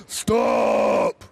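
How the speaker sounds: very low, loud monster bellow; deep bellow. A narrator's reading. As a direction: bellow it like a huge beast. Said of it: "bellowed the Bigfootosaurus".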